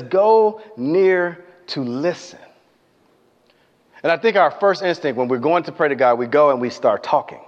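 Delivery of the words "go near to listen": each word of "Go near to listen" drawn out slowly; slow emphasis. Shown as a demonstration. Preacher stressing the verse to the congregation, very slow. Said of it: "It says".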